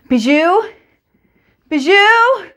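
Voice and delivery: high, loud desperate call for the dog; child shouting the dog's name; crying out. Acted. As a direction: call out for the lost dog, rising in panic. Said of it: "I cry".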